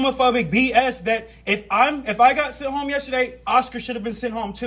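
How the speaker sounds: heated rant. Real, not acted. Worker protesting being sent home, shouting angrily. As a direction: rant in high indignant fury. Recorded office argument.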